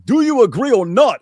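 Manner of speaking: loud high yell, imitating Ali G. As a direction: bark the question at them. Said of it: "he keeps yelling".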